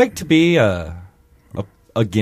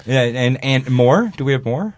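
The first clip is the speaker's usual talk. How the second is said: ordinary talk, nothing marked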